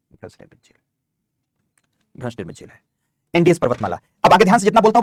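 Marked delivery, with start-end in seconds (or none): 0.1-2.8 very soft, voiceless whisper; whispered repeat. Real, not acted. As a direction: whisper it as if to yourself. Repeating to self while writing.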